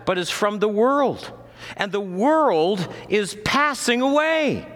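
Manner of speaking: high-pitched, driving sermon delivery; sustained high pulpit proclamation; impassioned preaching. Real, not acted. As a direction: preach it urgently, pitched well above normal talk. Preaches at full force.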